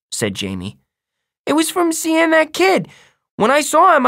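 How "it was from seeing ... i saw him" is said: put-on very high, excited small boy; loud little kid telling his tale; little brother. A narrator's reading. Little brother voiced in story.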